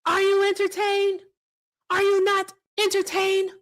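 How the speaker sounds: high, loud arena challenge; Gladiator shout. Retold or imitated. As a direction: roar it to the crowd like Maximus. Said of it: "and he's gonna say".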